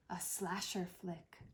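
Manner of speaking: whispered aside, unvoiced and slow; hushed, joking whisper. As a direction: breathe it out as a secret. Whispered aside in retold scene.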